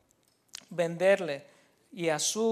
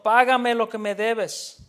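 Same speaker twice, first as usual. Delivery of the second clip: louder, demanding the debt back; harsh demand; 3 semitones higher, 7 dB louder than usual. Acted. Servant choking his fellow servant, a loud harsh demand. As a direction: seize him and demand payment.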